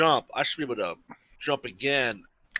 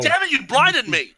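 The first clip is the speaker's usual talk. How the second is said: angry outburst; 9 semitones higher, 5 dB louder than usual. Acted. Character blinded by a spell.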